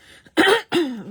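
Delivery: sharp, loud throat-clearing coughs; real coughing fit. Real, not acted. Crafter coughing mid-demo with a frog in her throat, loud coughs. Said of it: "J'ai un chat dans la gorge".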